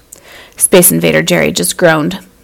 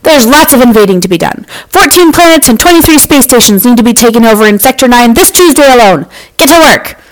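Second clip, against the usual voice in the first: loud, higher, pushy alien ruler; commanding boss voice; 5 semitones higher, 4 dB louder than usual. Acted. Grand Council Master ordering Jerry to work, loud bossy voice. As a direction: pile on the orders, rising to the end. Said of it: "in a voice that made Jerry think of lava pits".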